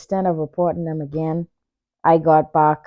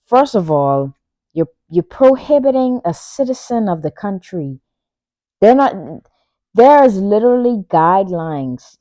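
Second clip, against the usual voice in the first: retold heated protest; 4 semitones higher, 7 dB louder than usual. Retold airport argument with staff.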